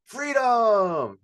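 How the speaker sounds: loud, high, drawn-out shout; huge crowd cheer, stretched and falling; full-energy rallying cry. Shown as a demonstration. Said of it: "I didn't feel the energy".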